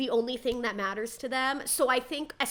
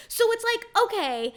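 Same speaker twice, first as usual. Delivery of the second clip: ordinary talk, nothing marked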